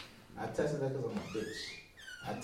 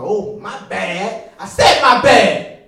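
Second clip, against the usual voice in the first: slow, loud, drawn-out insistence; hammed-up apology; 6 semitones higher, 19 dB louder, much slower than usual. Drinker apologizing for taking wrong drink, hammed-up insistence. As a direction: stretch it out and build to a shout.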